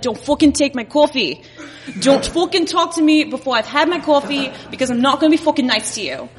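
put-on brash Long Island accent. Long Islander warning people before her coffee, brash Long Island accent. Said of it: "Indian people on Long Island".

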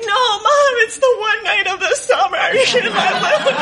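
high, wailing, laughing through mock tears; crying plea. Little sister pleading with mom about the banquet, mock-tearful wail. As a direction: beg through hammed-up sobs. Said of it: "crying probably more than they are".

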